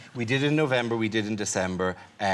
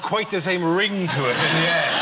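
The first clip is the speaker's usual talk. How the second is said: ordinary talk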